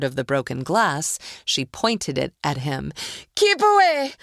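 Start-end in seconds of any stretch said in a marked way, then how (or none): 3.4-4.1 screaming. A narrator's reading. Roz threatening with glass.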